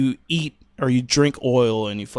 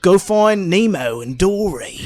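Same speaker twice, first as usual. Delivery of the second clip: put-on broad Aussie; light-hearted Australian impression; mock Australian accent; 5 semitones higher, slower than usual. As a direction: play at being Australian. Trading mock Australian phrases.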